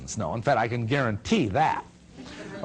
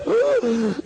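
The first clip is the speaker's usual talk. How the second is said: high, loud gasp of shock; 14 semitones higher, 5 dB louder than usual. Retold or imitated. Whole lodge reacting to the beer answer, a loud shocked gasp. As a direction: gasp as if hearing sacrilege. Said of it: "all the lodge goes".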